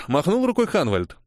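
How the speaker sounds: ordinary delivery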